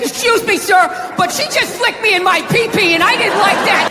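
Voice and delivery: high, prissy mock-polite complaint; whiny complaint. A man tattling to another man, high mock-polite whine. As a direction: play it high and put-upon. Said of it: "walk up to another man and be like".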